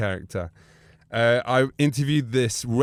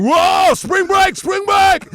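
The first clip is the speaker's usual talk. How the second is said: much higher and louder party whoop; pumped-up frat shout; 9 dB louder, much slower than usual. Retold or imitated. Imitating wrestlers hyping themselves, a loud frat-boy shout. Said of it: "all pumped up going".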